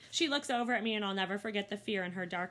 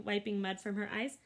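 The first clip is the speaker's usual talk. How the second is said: plain reading voice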